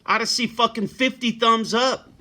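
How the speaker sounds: a real, heated call-out to silent viewers; angry rant. Real, not acted. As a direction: chew out the lurkers who never help.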